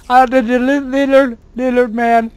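"little man" said for "lizard man", tongue tripping on "li-"; tongue-tied "little man" where "lizard man" is meant; fumbling lizard. Real, not acted. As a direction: stumble so "lizard" comes out "little". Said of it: "I don't know why I can't say lizard".

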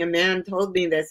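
ordinary talk, nothing marked

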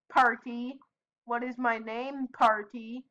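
ordinary talk, nothing marked

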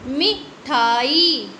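मिठाई with the long ई drawn out slowly; teacher showing how to stretch मिठाई; stretched reading. Shown as a demonstration. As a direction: draw out the end of मिठाई. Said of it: "हम ऐसे ही बोलते हैं मिठाई".

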